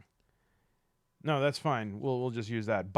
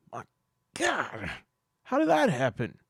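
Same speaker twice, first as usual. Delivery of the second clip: shocked; 4 semitones higher, 5 dB louder than usual. Acted. Shocked at hockey story.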